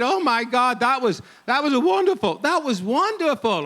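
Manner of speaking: high, loud mock-Alabama gushing; imitated American accent, high and loud; attempted Alabama accent. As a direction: gush in a put-on Alabama accent. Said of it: "let me put it as best I can".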